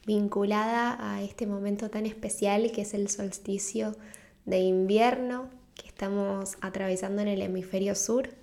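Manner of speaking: usual speaking voice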